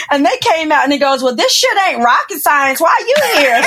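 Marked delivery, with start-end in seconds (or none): 1.2-3.6 lightly voiced coworker, chuckling through it; ribbing her with a laugh, rising at the end; teasing laugh. Coworker ribbing the former NASA physicist, with a teasing laugh.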